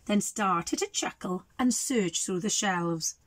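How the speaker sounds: plain reading voice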